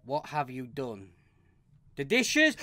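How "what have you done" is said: ordinary delivery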